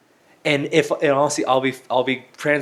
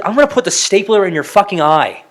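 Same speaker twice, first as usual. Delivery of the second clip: higher, louder mock threat; hammed-up outburst at a pedantic teacher; mock furious retort; 7 semitones higher, 6 dB louder than usual. Acted angry retort to teacher.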